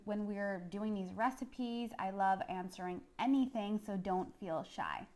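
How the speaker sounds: ordinary talk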